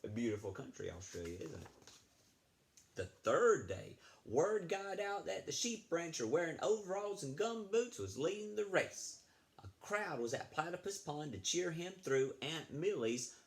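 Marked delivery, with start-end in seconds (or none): none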